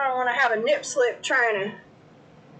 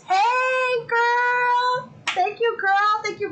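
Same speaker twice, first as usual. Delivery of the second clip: excited greeting; 11 semitones higher, 3 dB louder, much slower than usual. Real, not acted. Streamer squealing hello to a chat friend, excited.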